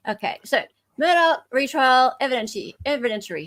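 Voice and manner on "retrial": ordinary delivery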